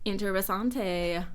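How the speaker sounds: interesante with an American r, flat English vowels; clumsy gringo take on the Spanish word; bad Spanish accent. Shown as a demonstration. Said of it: "I said that with a horrible accent".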